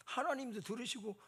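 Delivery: ordinary talk, nothing marked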